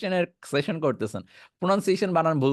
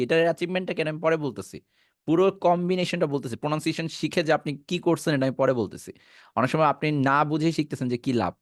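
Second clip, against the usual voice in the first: ordinary delivery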